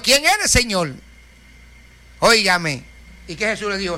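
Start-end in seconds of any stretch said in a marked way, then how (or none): none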